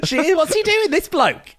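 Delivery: high, mock-incredulous squawk; baffled viewer. Interviewer joins in as a baffled TV viewer, mock-incredulous.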